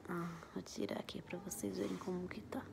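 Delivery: soft unvoiced whisper to the camera. Real, not acted. Vlogger whispering to viewers while showing her skin. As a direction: whisper it close, as if someone might wake.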